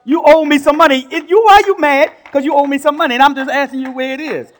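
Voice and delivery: loud, high, heated demand for owed money; mock angry demand. Preacher acting out a lender, loud and angry over owed money. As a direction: play the fed-up lender shouting for his cash.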